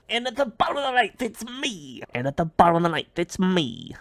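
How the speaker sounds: high, excited voice for a little ballplayer; rapid, high announcement of a tiny hero; sports bit character. Acted. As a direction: play the little man's big moment. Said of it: "when I do that bit, I imagine".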